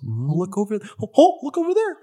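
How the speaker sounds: lightly voiced sneaky bee; high sing-song lure. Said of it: "it just goes".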